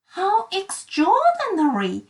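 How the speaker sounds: high, delighted exclamation; amazed exclamation. Acted. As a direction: gasp in wonder. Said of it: "exclaimed little Miss Sunshine".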